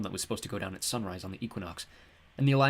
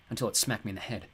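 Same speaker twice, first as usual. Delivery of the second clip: plain reading voice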